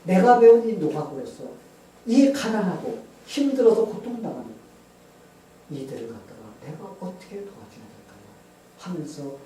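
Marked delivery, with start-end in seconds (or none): 0.0-8.2 earnest prayer. Retold or imitated. Asking God how to help struggling farmers, earnest prayer. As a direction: pray out forcefully, then let it fall away. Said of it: "하나님께 기도했어요".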